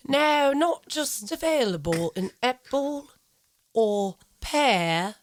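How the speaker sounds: mock highbrow British commercial voice, slow and drawn out; hammed-up, deliberate upper-crust British announcer; highbrow British accent. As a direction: sell it as an upper-class British advert, savoring each word. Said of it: "okay, highbrow muffin commercial?".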